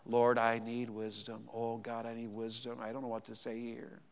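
hushed, low, imitated inner prayer; silent heartfelt prayer. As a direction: pray it very softly, as if inwardly. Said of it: "so I said in my heart".

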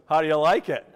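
punchy imitation of a vindicated wife; gloating retort. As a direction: throw it back loudly, as the wife. Wife's gloating retort to dad.

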